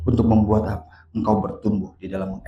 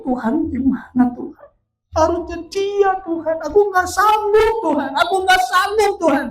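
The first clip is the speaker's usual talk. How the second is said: very high, loud imitated pleading; desperate prayer; 6 dB louder than usual. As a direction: cry out to God at breaking point. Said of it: "Ketika dia berdoa". (jeritan doa putus asa)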